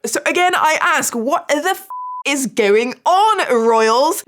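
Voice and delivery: mock-posh British outrage at the royals; bad British accent demanding answers; terrible British accent. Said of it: "sorry for my terrible English British accent".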